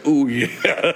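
put-on gloating croon ending in a laugh; mock-villain catchphrase. Host quotes himself after cutting drivers off, gloating playfully. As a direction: draw it out, then giggle. Acts out his pinky-in-mouth catchphrase.

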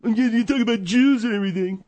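high-pitched mocking repeat; mocking echo. Mimics the complaint back.

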